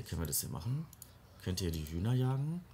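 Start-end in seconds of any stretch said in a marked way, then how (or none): none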